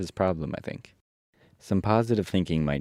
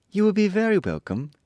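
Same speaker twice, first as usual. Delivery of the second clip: put-on high, louder girl character voice; Dorothy; 5 dB louder than usual. A narrator's reading. Dorothy welcoming the lion along, high girlish voice. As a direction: raise the pitch for a welcoming heroine. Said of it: "answered Dorothy".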